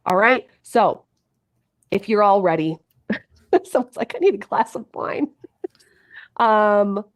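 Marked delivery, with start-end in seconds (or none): none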